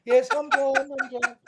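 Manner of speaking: high, squeaky-pitched mock answer; hammed-up high little voice; comic meek reply. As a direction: pitch it way up, then shrink away. Comic reply after shouting Satan.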